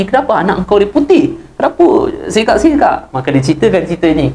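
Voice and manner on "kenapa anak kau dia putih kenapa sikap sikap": puzzled question. Acted. Pious guest questions the husband.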